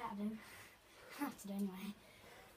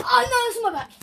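high, loud squealing oh no; startled cry; 8 semitones higher, 8 dB louder, faster than usual. Real, not acted. Boy splashed on his back, high startled cry. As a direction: yelp as cold water hits you.

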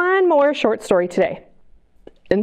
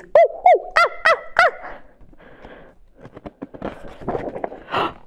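monkey calls. Acted. Teacher reading to kids about pepper monkeys: high monkey hoots. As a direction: hoot like a playful monkey.

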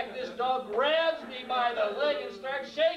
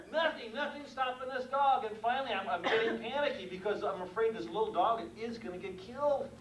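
ordinary talk, nothing marked

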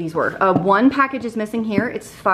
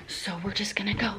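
playful whisper on the way to the basement; mock spooky whisper; 10 dB quieter than usual. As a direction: whisper it like sneaking into a horror film. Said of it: "I feel like there should be scary music".

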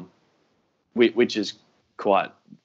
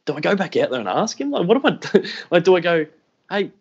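much higher, fretful, laughing through it; flustered musing; 8 semitones higher than usual. Retold or imitated. Wondering whether to confront the staring kid, flustered and high. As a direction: replay your own panicky thoughts. His anxious inner monologue, retold.

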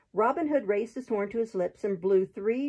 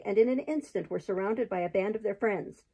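ordinary delivery, nothing marked

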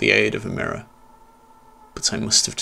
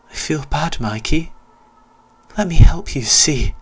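high, swelling to a loud sinister promise; sweet pity turning to threat; mock-sympathetic ghost boy; 7 semitones higher, 6 dB louder than usual. Ghost boy taunting Mikey, high then loud menace.

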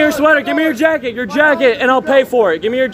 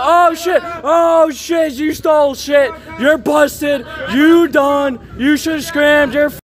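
real triumphant yelling at a thief; excited accusing shout; 6 semitones higher, slower than usual. Real, not acted. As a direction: call him out as busted. Accusing thief as cops arrive.

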